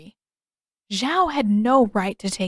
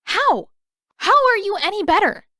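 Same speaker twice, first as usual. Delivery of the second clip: put-on higher, louder sharp girl voice; girl shooting back; 12 semitones higher, 6 dB louder than usual. A narrator's reading. Girl retorting to Zuko, higher and louder. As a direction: fire the retort back at him.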